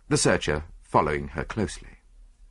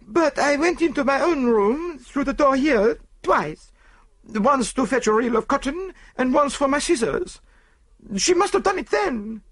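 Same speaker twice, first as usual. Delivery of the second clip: French maid voice; 3 dB louder than usual. A narrator's reading. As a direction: explain defensively. Celestine explaining.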